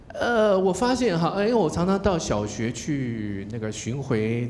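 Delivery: ordinary delivery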